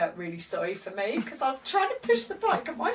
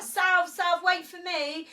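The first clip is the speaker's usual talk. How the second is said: high calling out; calling after him; 7 semitones higher, slower than usual. Retold or imitated. Wife calling after her husband cycling off, high shout. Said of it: "and I was like".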